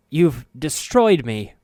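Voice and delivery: loud anguished scream. A narrator's reading. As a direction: scream it in despair. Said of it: "Soego screamed".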